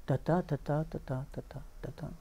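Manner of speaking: soft ta TA ta TA iambic beat; iambic rhythm. Shown as a demonstration. Translator showing the iambic pentameter in his ear, soft chanting. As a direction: tap out the iambs gently. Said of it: "il existe dans mon oreille".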